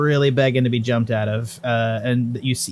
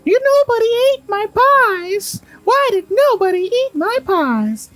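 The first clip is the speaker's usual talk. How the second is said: very high falsetto lament; distressed old lady; 4 dB louder than usual. Acted. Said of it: "the lady goes".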